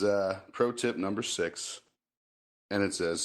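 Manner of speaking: usual speaking voice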